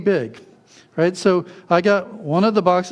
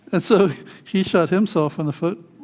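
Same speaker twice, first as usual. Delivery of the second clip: ordinary delivery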